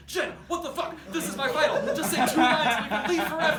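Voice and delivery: high, loud exasperated yell. Retold or imitated. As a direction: yell at her in disbelief, pleading. Shaking down petrified scene partner.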